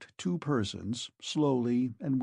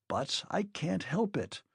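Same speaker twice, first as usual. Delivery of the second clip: mild plea in a higher voice; understated, higher-pitched pleading; 6 semitones higher, 3 dB quieter than usual. A narrator's reading. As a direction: plead gently, pitch lifted. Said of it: "pleaded the doctor".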